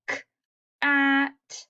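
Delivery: K-at split into its onset and rime, stretched; sounding out. Shown as a demonstration. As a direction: sound out "K-at" slowly. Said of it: "When I sound it out".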